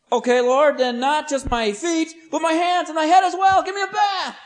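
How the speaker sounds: high, loud, hammed-up plea climbing to a shout; extreme eagerness. Peter now begs Jesus for a bath, extreme. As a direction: give in completely, escalating excitedly. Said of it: "he's extreme on the other end".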